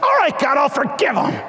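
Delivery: high mock shout of surrender to God; giving in. Pastor acting out finally giving in to God. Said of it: "I was like".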